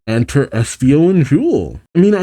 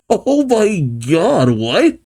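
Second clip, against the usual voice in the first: laughing disbelief; 8 semitones higher, much slower than usual. Real, not acted. Laughing at the plant fire.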